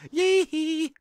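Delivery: high, loud falsetto whoop. Acted. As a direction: squeal a parody pop-star "hee". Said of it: "can't really do [name]".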